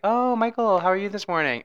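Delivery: high, sing-song greeting; put-on neighborly hello; imitating friendly neighbor. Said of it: "they're like".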